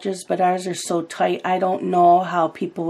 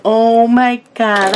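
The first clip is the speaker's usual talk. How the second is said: amused exclamation; 4 semitones higher, 8 dB louder, slower than usual. Retold or imitated. Acts out own amused reaction.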